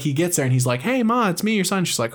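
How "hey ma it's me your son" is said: higher, gentle son's voice greeting his mother; patient son. Retold or imitated.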